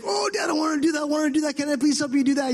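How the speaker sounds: put-on high, fast, eager kid begging Dad; excited little son. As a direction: beg like a thrilled five-year-old. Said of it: "And he's like".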